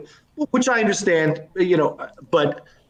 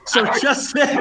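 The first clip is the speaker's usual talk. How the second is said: cracking up, higher-pitched; helpless laughter; 7 semitones higher than usual. Real, not acted. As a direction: try to carry on through real laughter. Cracks up as dogs interrupt.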